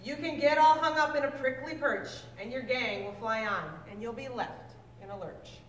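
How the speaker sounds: normal talking voice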